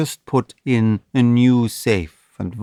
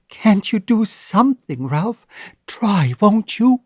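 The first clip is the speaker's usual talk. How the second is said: higher, anxious plea; pleading young woman; 9 semitones higher than usual. A narrator's reading. Annabel pleading with Ralph to save the child. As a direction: beg him to help, hopeful.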